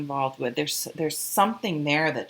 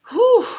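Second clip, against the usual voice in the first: high, loud whew; whew of release; 13 dB louder than usual. Real, not acted. Reader pulling herself together, loud whew of release. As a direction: blow off the emotion in one whoop. Shakes off emotion to refocus.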